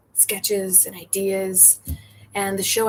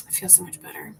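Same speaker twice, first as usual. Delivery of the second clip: whispered aside; 2 semitones lower, 11 dB quieter than usual. Real, not acted. Unvoiced aside.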